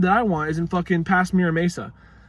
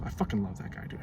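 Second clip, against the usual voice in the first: low, soft murmur; softer, lower aside; quiet affection; 6 semitones lower, 10 dB quieter, faster than usual. Shown as a demonstration. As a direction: say it softly to yourself. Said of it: "repeated as whisper".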